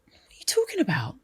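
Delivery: fast whisper; whispered retort. Retold or imitated. Daughter imitating mum's baffled reply, whispered. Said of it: "And she was like".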